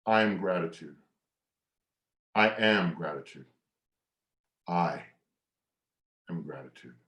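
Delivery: calm affirmation trailing to a hush; mantra. Shown as a demonstration. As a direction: say it to yourself, fading away. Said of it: "So I like to say".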